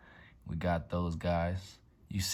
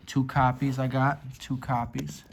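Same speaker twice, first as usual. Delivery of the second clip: excited, high and loud; 6 semitones higher, 6 dB louder than usual. Real, not acted. Excited about pulls.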